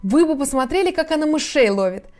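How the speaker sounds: high, enthusiastic boasting; eager, hurried. A narrator's reading. Alice boasts of her cat's hunting, eagerly. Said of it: "заторопилась Алиса".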